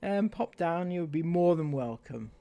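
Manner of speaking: lightly put-on Welsh lilt, sing-song and mocking; a mocking Welsh voice inviting people over; a hint of Welsh accent. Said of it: "Bloody Wales, indeed".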